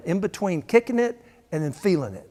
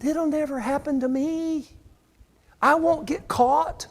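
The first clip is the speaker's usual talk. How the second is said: mock naive boast; 11 semitones higher than usual. Mocking a naive person's boast.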